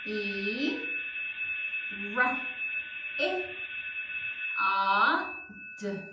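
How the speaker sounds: isolated sounds, long pauses between; dictation sound by sound. Shown as a demonstration. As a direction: say each sound as if writing it. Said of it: "say it as you write it".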